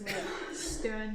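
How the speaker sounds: normal talking voice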